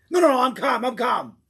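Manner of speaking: high, loud shout of denial; shouting I'm calm. Acted. Angry man yelling at a friend that he's calm. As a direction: yell that you're calm.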